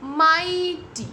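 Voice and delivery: माइटी slow and loud, "maai-tee"; long I sound. Shown as a demonstration. Teacher showing GH is silent, drawn-out माइटी. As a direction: stretch the first vowel of माइटी. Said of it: "GH का sound कहीं पे भी नहीं".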